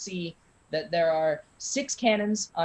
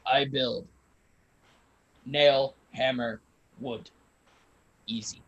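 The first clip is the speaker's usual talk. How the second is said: burly carpenter voice; 5 semitones lower than usual. Acted. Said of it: "burly human guy".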